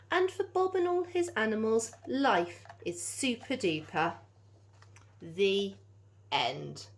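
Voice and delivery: lively storybook read-aloud; animated picture-book narration; reading to children. A narrator's reading. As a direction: bounce the rhymes for little listeners.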